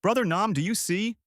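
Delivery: high-pitched calling; restrained triumphant shout; mild shout. A narrator's reading. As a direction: call out to someone absent, pitch well up.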